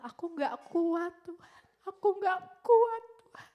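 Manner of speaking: high cry dropping to a faint end; anguished plea. Retold or imitated. Girl in prayer telling God she can't bear it, anguished.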